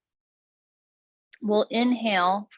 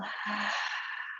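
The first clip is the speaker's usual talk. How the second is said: long open-mouthed sighing breath out; demonstrated exhale; 11 dB quieter than usual. Shown as a demonstration. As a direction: let all the air out in a soft, slow sigh. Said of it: "open the mouth, exhale".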